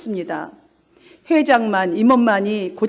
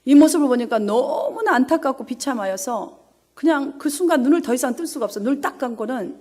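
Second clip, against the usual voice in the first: ordinary delivery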